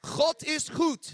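"God is goed" slow and high, with a guttural g; Dutch phrase. Shown as a demonstration. Preacher teaching the congregation, slowly and high. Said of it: "you gotta learn this now".

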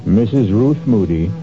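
ordinary delivery

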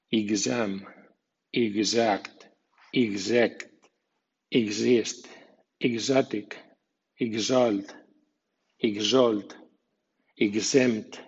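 "exempt", "exert": igz, stressed on the following vowel; X as voiced gz. Shown as a demonstration. Teacher models X before a stressed vowel, igz in "exact". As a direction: say "exact", "exist", "exotic" with a gz sound. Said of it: "con G y con S sonora".

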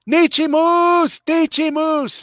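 high, loud call, stretched out slow; retold yelling. Man at his truck hollering to his wife, high calls. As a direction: holler the name across the lot, waving. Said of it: "he started screaming".

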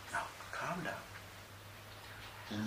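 very slow, soft, breathy calming words; calming hush. Retold or imitated. To the furious young man, a slow soft calming hush. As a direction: soothe him down gently.

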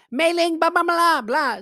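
high, loud gibberish call; mock Chinese shouting. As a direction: yell for a coworker in fake Chinese. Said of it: "Starts speaking some Chinese".

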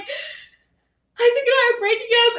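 high, slow, tearful telling; a crying voice breaking the news; tearful announcement. Retold or imitated. Telling her siblings through tears, high and slow.

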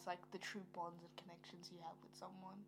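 breathy whisper. Real, not acted. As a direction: drop to a whisper. Soft aside.

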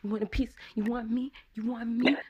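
imitating a small child squaring up; little thug daughter. Daughter putting up thug hands, high mock threat. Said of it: "she do these thug hands".

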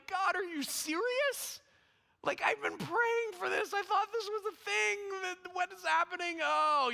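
very high, anguished mock wail to God; crushed. Man cries out to God after failed word, crushed. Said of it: "and I was crushed".

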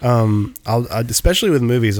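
normal talking voice

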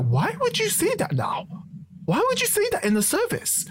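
very high-pitched, incredulous complaint; exasperated reproach. Retold or imitated. A boy to the kid who exposed him, exasperated. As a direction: plead in mock disbelief at the betrayal.

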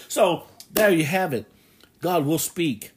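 ordinary talk

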